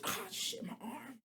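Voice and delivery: hushed, whispery mock groan of pain; hammed-up breathless wince; mock strain. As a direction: act crushed by a heavy weight, almost voiceless. Acts out straining under heavy shoulder.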